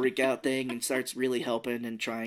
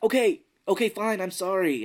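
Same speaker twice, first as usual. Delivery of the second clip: loud exasperated apology; 7 semitones higher, 5 dB louder than usual. Retold or imitated. Grudging apology while chased.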